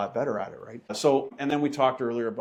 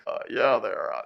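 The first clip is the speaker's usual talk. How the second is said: lowered trucker radio voice. Retold or imitated. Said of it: "they, they change their voice".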